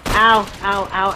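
loud pained yelps. Real, not acted. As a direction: yelp like you're hit. Getting shot in game.

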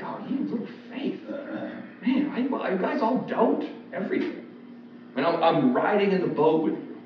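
high-pitched, weary reproach; mock exasperation. Jesus answers the panicked disciples.

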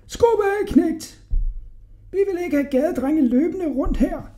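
very high, scolding mock yell; shouting angry woman. A narrator's reading. Woman chasing Hodja off from her window, high scolding. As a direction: scold from above in a high-pitched voice. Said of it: "stak en kone hovedet ud ... og råbte".

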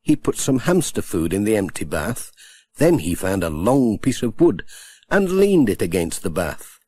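usual speaking voice

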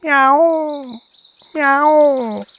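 high, drawn-out meow; a cat's high meow at the sight of meat. A narrator's reading. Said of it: "he meowed".

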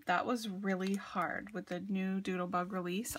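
ordinary talk

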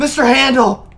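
loud, high delighted cry; excited shout. Real, not acted. Excited greeting of an object.

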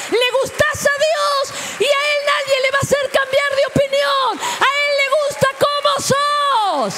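high, loud proclaiming shout; preacher shouting at the climax; preaching shout. Real, not acted. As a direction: shout it out to the congregation.

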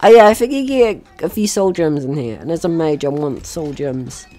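ordinary talk, nothing marked

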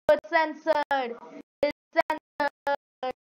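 mock it word by word; very slow mock drawl. Gamer mocking that his text got censored, slowly. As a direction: stretch It's censored out slowly.

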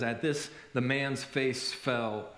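slow, low, soft and sorrowful; heartbreaking reading. A narrator's reading. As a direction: let each phrase sink with sadness. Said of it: "this next part is heartbreaking".

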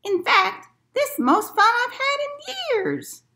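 put-on very high old lady voice; high-pitched old lady having fun. A narrator's reading. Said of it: "she added".

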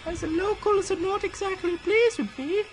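high, slow falsetto reading; high-pitched letter voice. Acted. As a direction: read it in a squeaky put-on voice. Reads friend's letter in a voice.

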